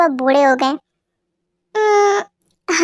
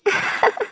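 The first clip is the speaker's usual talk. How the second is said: breathy amused laugh; 3 semitones higher, 5 dB quieter than usual. Acted. Boss laughs at crying reason.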